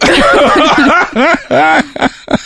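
high burst of real laughter. Real, not acted. Laughs at caller.

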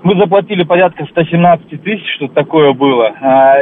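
normal talking voice

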